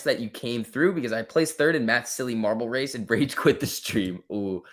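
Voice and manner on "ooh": normal talking voice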